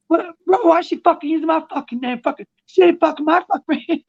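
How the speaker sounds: very high squeaky mock rant, a bit fast; high mocking whine. Mocking the angry streamer to a friend, high squeaky rant. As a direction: rant in a shrill cartoon voice to mock him. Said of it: "He's like".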